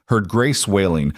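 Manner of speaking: plain reading voice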